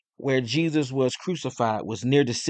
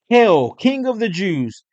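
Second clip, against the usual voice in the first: raised, slow, ringing proclamation; loud hail; 7 semitones higher, 6 dB louder, slower than usual. A narrator's reading. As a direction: draw out HAIL loudly, higher than narration. Soldiers mock the crowned Jesus.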